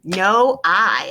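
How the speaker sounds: ordinary delivery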